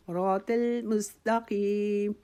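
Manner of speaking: راتل مستقیم with heavy ر and ط, slow. Shown as a demonstration. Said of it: "موٹا پڑھیں گے".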